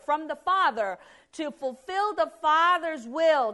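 high and loud, slowing into a hallelujah; preacher crying out glory to the Father; fervent preaching. Acted. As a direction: lift the congregation to praise.

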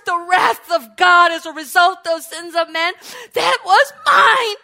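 loud, building cry; preaching shout. Real, not acted. Preacher declaring the curse was his, loud shout. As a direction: lift the voice over the congregation.

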